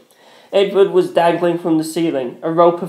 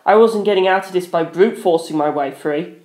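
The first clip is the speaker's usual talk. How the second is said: plain reading voice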